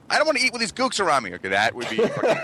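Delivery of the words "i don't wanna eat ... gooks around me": mock bratty kid. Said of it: "It's not like".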